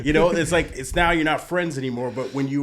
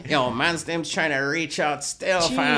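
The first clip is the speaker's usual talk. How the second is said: slow, louder Toronto slang, last word leaps high; Toronto accent; 4 semitones higher, 5 dB louder, slower than usual. Retold or imitated. Answering his friend's Toronto slang with slow loud slang. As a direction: drawl the Toronto line and pop fam way up. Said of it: "that's how they talk".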